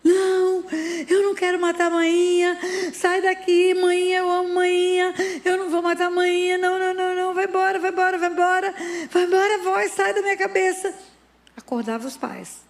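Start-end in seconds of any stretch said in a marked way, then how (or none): 0.0-10.9 put-on high, loud panicked child's cry; child screaming. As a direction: scream it as a terrified boy pushing the voice away. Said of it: "Aí começava a gritar". (grito desesperado de criança, agudo e forte)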